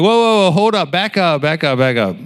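high-pitched rapid-fire backtracking; alarmed halting. Retold or imitated. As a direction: start high and come down, putting on the brakes.

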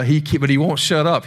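normal talking voice

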